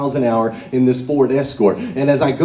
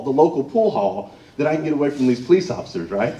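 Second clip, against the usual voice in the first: ordinary talk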